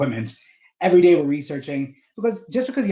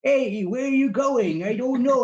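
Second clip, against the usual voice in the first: put-on wobbly elderly voice, slowed right down; old person voice; 8 semitones higher than usual. Shown as a demonstration. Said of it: "like, a good old person voice".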